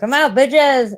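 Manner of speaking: loud, high playful send-off; imagined sign-off. Pretend. Streamer trying out an outro for viewers, brash shout. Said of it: "play an outro song and be like".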